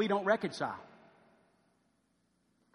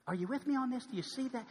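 usual speaking voice

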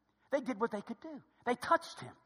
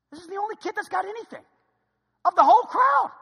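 very high pitch, bursting loud at the end; incredulous; 16 dB louder than usual. Acted. Disciple reporting the only kid with food, incredulous. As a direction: squeeze it up high, then blare the last phrase.

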